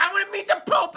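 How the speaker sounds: high, smug nerdy voice; squeaky know-it-all lecturing about hierarchy; mocking J D Vance. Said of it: "he's like".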